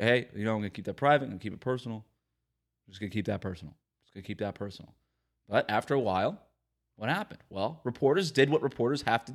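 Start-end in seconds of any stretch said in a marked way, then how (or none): 0.0-4.9 low, soft, mumbled mock of a subdued player; subdued mumble. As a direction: mumble it flat and quiet like a reluctant athlete. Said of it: "in his normal subdued tones".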